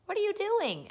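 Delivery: put-on high child voice, lightly done; curious boy. A narrator's reading. Tommy asking Pippi, lightly voiced child. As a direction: ask like a curious little boy.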